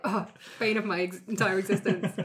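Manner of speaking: mock weary complaint in a put-on voice; hammed-up suffering; exasperated groan. Fed-up agent grumbling about Renko's bureaucracy, mock weary. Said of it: "You're just like".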